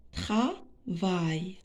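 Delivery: travail as tra, pause, vail; teacher sounding out travail; split into syllables. Shown as a demonstration. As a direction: break travail into two separate syllables. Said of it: "on va découper les mots en syllabes".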